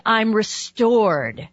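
drawn out very slowly, as the dead dog speaking; a lightly voiced dog, spoken slowly; slow dog message. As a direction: let the dog's words land slowly. Voicing the dead dog's message.

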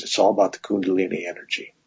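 low, soft retold mumble; imitated mumble. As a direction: murmur it low, imitating an offhand remark. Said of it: "kinda mumbles something about".

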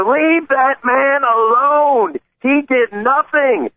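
high, slow pleading woman's voice; older woman pleading. Retold or imitated. Host voicing older woman on the bus, slow high pleading. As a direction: drag it out like an older woman defending him.

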